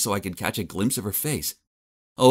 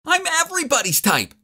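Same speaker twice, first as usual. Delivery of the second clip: high-pitched indignant shout. Acted. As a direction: shout it back in outrage. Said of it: "She shouted back".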